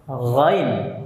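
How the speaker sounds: "ghain" opened on a voiced gargled throat fricative; letter ghain demo. Shown as a demonstration. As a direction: sound "ghain" from the upper throat, drawn out. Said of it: "Yang pertama huruf". ("ghain" dari tenggorokan bagian atas)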